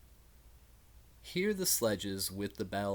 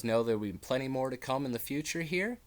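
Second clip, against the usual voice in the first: ordinary delivery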